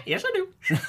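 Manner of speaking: high squawking parrot. Pretend. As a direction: screech it like a parrot. Parrot answers back.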